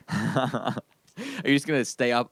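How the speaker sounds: ordinary talk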